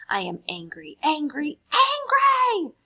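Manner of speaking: building from plain to a high loud shriek; child's tantrum rising to a scream. Acted. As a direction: wind up into a full tantrum shriek. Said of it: "shrieked enraged Belinda Blue".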